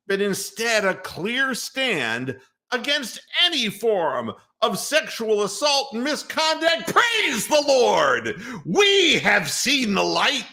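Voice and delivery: sarcastic revival-preacher yell; mock preacher shout. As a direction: preach their statement back at them as a hammed-up sermon. Mocking the owners' statement as a sermon.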